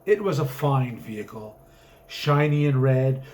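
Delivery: plain reading voice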